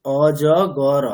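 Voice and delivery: অজগর stretched into o-jo-go-ro; sillabato lettera per lettera. Shown as a demonstration. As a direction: say অজগর slowly, sounding a vowel after final র. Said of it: "আমরা কি বাংলার ক্ষেত্রে এরকম বলি".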